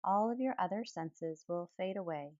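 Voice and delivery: ordinary delivery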